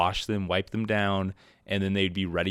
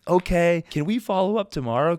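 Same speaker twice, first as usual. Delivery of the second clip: eager; 9 semitones higher, 4 dB louder than usual. Retold or imitated. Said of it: "I was like".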